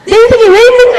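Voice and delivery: 높고 크게 치솟는 hammed-up despair; 과장된 절망. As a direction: cry out to God in mock despair. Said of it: "제가 그때 한번 절망했을 때가". (절망한 척 하늘에 외치듯)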